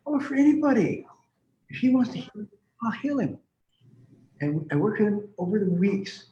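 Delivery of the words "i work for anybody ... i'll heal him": emphatic reassurance. Retold or imitated. Retelling his reply to the mother.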